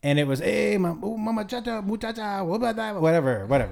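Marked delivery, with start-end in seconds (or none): 0.4-3.0 put-on softer, sketched-out comic Mexican accent; mock Mexican accent. Host recalls own stage bit, mock Mexican accent. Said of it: "I did a Mexican voice on stage".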